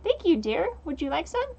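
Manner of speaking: lightly voiced buggy critter, rising questions. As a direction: lilt it up like a polite little bug. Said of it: "trying to give it a little, like, buggy voice".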